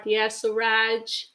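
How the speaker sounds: normal talking voice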